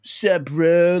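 lightly voiced total bro; frat bro greeting. Frat bro greeting his buddies, broey. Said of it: "a total broy, like".

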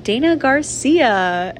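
slow, drawn-out name call like a teacher; a hint of a stiff announcer reading a name; mock formal roll call. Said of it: "saying my last name".

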